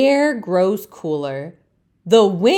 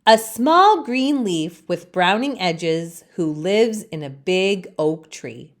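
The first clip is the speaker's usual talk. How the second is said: plain reading voice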